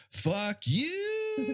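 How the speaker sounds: high drawn-out falsetto send-off; mock a callous brush-off in a long squeal; mocking dismissal. Military brushing off orphaned kids, mocking falsetto.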